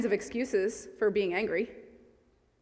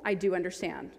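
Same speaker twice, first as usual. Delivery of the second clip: ordinary talk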